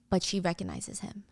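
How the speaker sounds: ordinary talk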